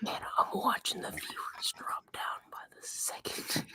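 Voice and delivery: breathy stage whisper in character. Acted. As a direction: keep whispering it. Keeps whispering as Banquo.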